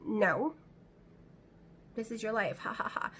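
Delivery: quiet, taunting voice of her own anxious brain, ending in a whispered laugh; mocking inner brain voice. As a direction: let the brain sneer at you softly, then breathe out a mock ha ha ha. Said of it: "your brain is just like".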